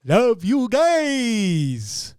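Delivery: loud, very slow and drawn out; a second go at the sign-off, drawn out; sign-off retake. Shown as a demonstration. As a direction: stretch the goodbye like a big finale. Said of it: "let's do it again".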